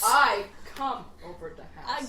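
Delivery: voiced, cracking up mid-line; laughing sexy voice. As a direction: say it while laughing. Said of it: "What's your sexy voice then?".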